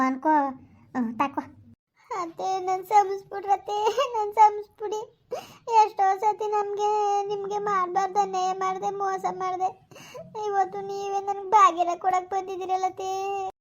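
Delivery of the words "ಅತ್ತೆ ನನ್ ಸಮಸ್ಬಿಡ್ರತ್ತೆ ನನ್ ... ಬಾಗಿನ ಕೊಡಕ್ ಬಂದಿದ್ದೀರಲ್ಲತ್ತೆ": put-on higher, slowed pleading voice of a young wife; pleading daughter-in-law. Daughter-in-law begs mother-in-law's forgiveness, higher and pleading. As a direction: beg your mother-in-law to forgive you.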